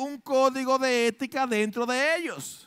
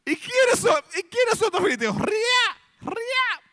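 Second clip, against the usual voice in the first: much higher, louder taunting roar; mocking giant's shout; 11 semitones higher, 3 dB louder, slower than usual. Preacher acts the Philistine giant taunting Israel, loud mocking shout. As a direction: bellow the challenge like a scornful giant. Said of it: "Eso fue lo que él dijo".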